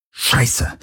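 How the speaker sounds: voiceless, sharp hiss; hissed curse. A narrator's reading. Galen cursing at the boarding threat, hissed. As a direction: spit it out under your breath. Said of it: "zischte Galen".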